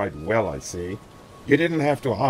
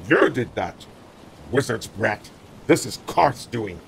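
raised, furious man's voice; angry accusation; 4 semitones higher, 4 dB louder than usual. A narrator's reading. Rune blames the boy and Karth, angry raised voice. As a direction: lash out at the boy.

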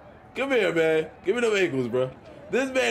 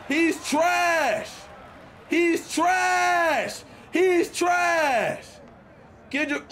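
trash-talk shout; 8 semitones higher, much slower than usual. Real, not acted. Gamer jeering his opponent Kendrick, high mocking shout. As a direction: jeer him, hit trash hard.

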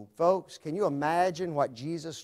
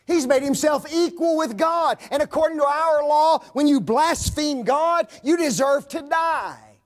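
raised, loud pulpit voice proclaiming scripture; full force preaching; 10 semitones higher, 8 dB louder than usual. Acted. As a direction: ring it out to the back pews.

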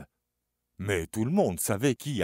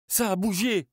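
high, loud alarmed shout; cry out; 10 semitones higher, 6 dB louder than usual. A narrator's reading. Charlie shouting to his friends after dropping the paw, alarmed. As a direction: shout that it moved. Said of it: "cria-t-il".

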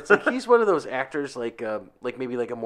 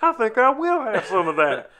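movie quote voice; 7 semitones higher, 5 dB louder than usual. Retold or imitated. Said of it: "we go back to Tim Meadows".

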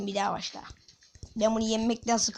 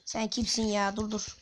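ordinary talk, nothing marked

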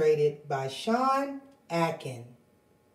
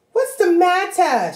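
high, loud goose voice; Goosey Lucy voice; 11 semitones higher, 5 dB louder than usual. Acted. Goosey Lucy asking the running birds, high and loud. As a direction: ask in alarm.

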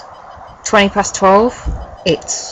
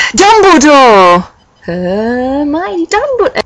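high, drawn-out mock-epic cry; dramatic reveal; 7 semitones higher, 3 dB louder, much slower than usual. Reader revealing who caught the couple, drawn-out mock-dramatic cry. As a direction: proclaim the name slowly, high and theatrical.

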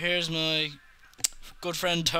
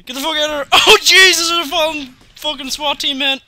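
high, loud crying-out panic; panicked cry; 8 semitones higher, 9 dB louder than usual. Real, not acted. Gamer fleeing a swat team, crying out in high loud panic. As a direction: scream it as the chase goes wrong.

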